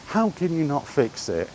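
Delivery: ordinary delivery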